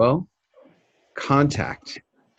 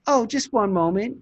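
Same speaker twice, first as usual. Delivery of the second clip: receptionist; 11 semitones higher than usual. Shown as a demonstration. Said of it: "Somebody's gonna say".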